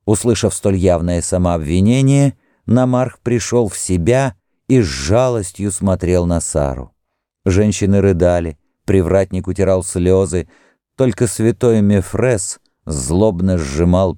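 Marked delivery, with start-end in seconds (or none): none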